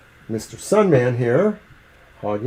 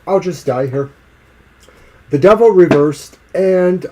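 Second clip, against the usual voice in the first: normal talking voice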